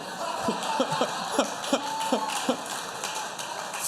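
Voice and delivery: long, breathy burst of laughter; hearty laugh. Real, not acted. Laughs at his own joke.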